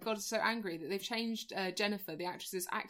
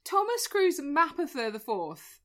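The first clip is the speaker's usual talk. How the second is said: grand announcement; 6 semitones higher, 6 dB louder, slower than usual. Pretend. Announcing full name again.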